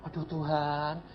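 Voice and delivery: very soft, low, groggy moan; acted sleepiness. Pastor woken by God to pray, sleepy groan. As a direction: mumble it quietly as if half asleep.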